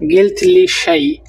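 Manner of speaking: গিলথলিশাই stretched slowly, starting high; slow careful model of গিলথলিশাই; slow demo. Shown as a demonstration. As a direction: pull গিলথলিশাই apart syllable by syllable. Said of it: "তাহলে বলবেন".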